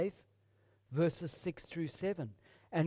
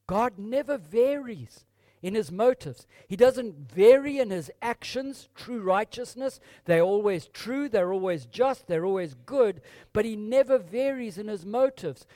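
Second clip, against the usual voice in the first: 1 fiery preaching; 4 semitones higher, 6 dB louder than usual. Acted. Preaching at full force.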